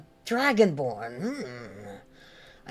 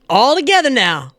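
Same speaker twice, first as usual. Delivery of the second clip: loud, higher rallying call; rally the whole tavern; 7 semitones higher, 8 dB louder than usual. Acted. Leads the tavern singalong.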